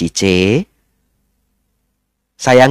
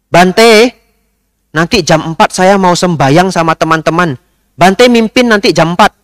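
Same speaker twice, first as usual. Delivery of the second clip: bossy lady's order; 7 semitones higher than usual. Retold or imitated. Pushy lady orders the monk to lead prayers, high and bossy.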